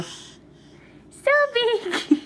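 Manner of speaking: very high, sing-song so big; high cheer. Real, not acted. Cheering baby's size game.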